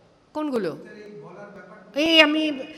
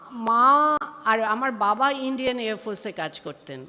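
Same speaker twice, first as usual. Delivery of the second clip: normal talking voice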